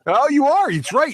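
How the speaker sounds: high, bright realization on are; sudden happy realization, pitch jumps up; delighted surprise. Real, not acted.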